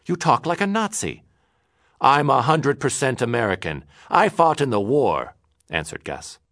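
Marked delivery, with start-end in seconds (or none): 0.0-1.2 high, loud accusation; crying out. Acted. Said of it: "Morris cried". 2.0-5.4 high, loud defiant claim; indignant. Acted. Angry quarrel.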